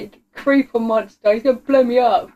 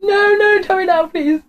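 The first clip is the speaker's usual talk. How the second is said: frantic begging, pitched up; high panicked pleading; 9 semitones higher than usual. Real, not acted. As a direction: beg in a panic. Begging in fear.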